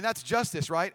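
ordinary delivery

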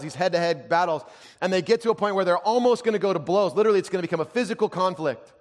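high, loud, driving storytelling; full-force preaching. Real, not acted. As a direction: preach the story with mounting intensity.

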